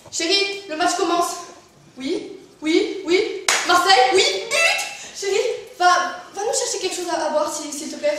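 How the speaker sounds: football fan shouting. Acted. Husband watching Marseille, loud excited shouting. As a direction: bellow at the TV and at your wife.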